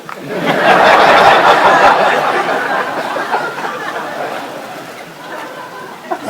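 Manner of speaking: long, loud, mostly breathy laugh; cracking up at the punchline; hearty real laughter. Real, not acted. Audience reacts to punchline.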